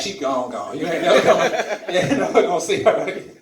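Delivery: ordinary talk, nothing marked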